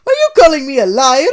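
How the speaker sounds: high, loud indignant Italian; Mario offended by Sonic, loud indignant. Acted.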